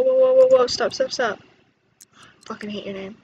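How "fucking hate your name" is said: a soft voiceless whisper, annoyed; whispered gripe. Real, not acted. Player grumbles at a Sim's name, whispered. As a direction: whisper the complaint under your breath.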